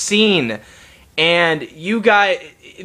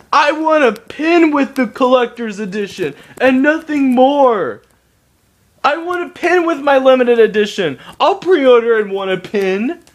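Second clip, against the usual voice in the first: higher, mocking voice of an online fan; mocking whiny fan; 7 semitones higher than usual. Ranting YouTuber mocks fans begging for a pin, in a higher mocking voice. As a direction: sneer out the fans' silly demand. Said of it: "that said".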